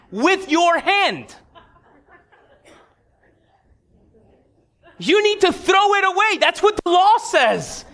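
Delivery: high, stern, scolding to the worker; hangry lecture. Retold or imitated. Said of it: "I was hangry".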